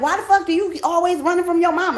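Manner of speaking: dad fed up on the payphone; imitating exasperated dad. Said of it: "he used to be like".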